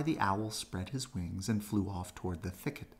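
ordinary delivery, nothing marked

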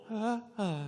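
high, soft little gasping sounds; fitful machine gasps. Retold or imitated. Xbox sputtering back to life, high little gasps. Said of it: "a fitful little".

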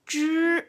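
Zhi with tongue tip curled back, no air; Chinese zhi. Shown as a demonstration. Teacher demonstrating the Chinese sound zhi. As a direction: retroflex zhi, held and clear. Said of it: "Elevar la punta de la lengua".

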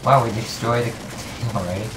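usual speaking voice

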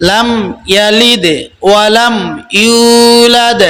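ইউলাদ with a drawn-out ইউ, slow; tajweed recitation. Shown as a demonstration. Teacher repeats the correct verse, slow chant. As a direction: stretch the ইউ of ইউলাদ one alif. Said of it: "সেজন্যই এক আলিফ টান হবে".

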